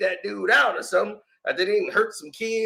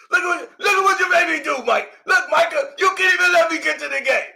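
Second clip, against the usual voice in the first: mock blaming yell; 6 semitones higher, 3 dB louder, faster than usual. Impersonated Stephen A blaming Mike.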